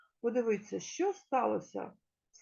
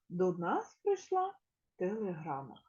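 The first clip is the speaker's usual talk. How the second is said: ordinary talk, nothing marked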